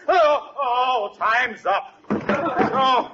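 strained in pain. Acted. Still sitting on the pilot light.

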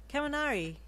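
high, loud cheerful call; happy greeting. A narrator's reading. Deku spots his friend Charge Bolt, happy greeting. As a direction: sing out the name gladly. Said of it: "Deku said happily".

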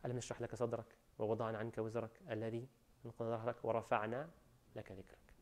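rapid soft recitation of the verses; تلاوة سريعة هادئة; quick Quran recitation. Acted. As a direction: rush through the surah under the breath.

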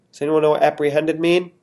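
plain reading voice

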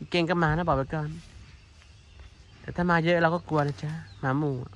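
normal talking voice

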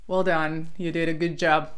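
ordinary delivery

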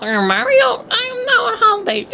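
high, sweet cartoon princess; Princess Peach voice. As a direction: coo it like a storybook princess. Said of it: "I shouldn't be doing Princess Peach's voice".

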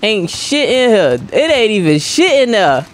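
high, drawn-out mock griping; exaggerated complaint. Gamer griping about an empty room, exaggerated complaint. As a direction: pitch it up and stretch the gripe.

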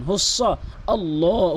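হুস্স আল্লাহ very slow, long ল; Quran recitation. Shown as a demonstration. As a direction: chant হুস্স আল্লাহ slowly for practice. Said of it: "এভাবে বলবেন".

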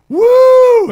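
huge high, loud whoop; whooping shout. Retold or imitated. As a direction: burst in with a wild whoop. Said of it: "we just hear".

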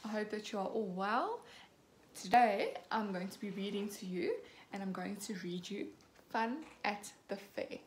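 ordinary delivery, nothing marked

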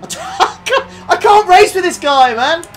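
loud, high, half-crying laugh; fed up but cracking up; laughing exasperation. Real, not acted. Streamer bumped again by a rival, laughing exasperation.

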